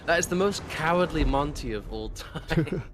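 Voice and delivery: laughing mockery. Real, not acted. Friend mocking a teammate's cowardly play, loud teasing. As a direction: roast him with glee.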